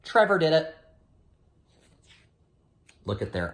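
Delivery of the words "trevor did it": louder, higher tattletale pug; sneaky pug blaming. Acted. Pug blaming the dog, light tattletale voice. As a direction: point the finger, a little loud and high. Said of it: "And then he'd just point and say".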